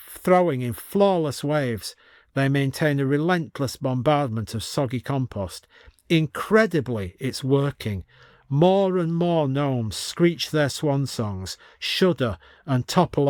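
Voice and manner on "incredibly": ordinary delivery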